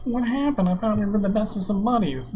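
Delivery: hammed-up wounded pal, pitch pushed up; mocking hurt friend. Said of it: "they're like".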